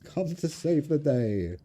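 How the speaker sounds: slow, stretched-out upper-class voice; posh Charles Bonzo. Acted. Card player voicing his rescuer, slow and posh. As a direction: announce the rescuer grandly and very posh. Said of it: "I talk very posh when Charles comes out".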